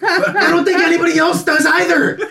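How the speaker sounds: high, loud furious rant; berserk rage. Real, not acted. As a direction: yell it in outraged disbelief. Angry rant about booking.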